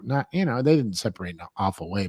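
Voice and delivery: ordinary delivery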